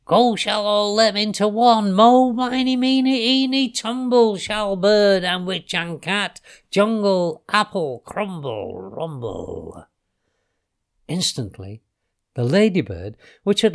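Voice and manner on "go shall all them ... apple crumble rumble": high, loud monotone chant, dropping at the end; ringing chant that sinks away on the last words; spell incantation. A narrator's reading. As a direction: intone it like a witch's spell. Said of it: "she read the spell backwards".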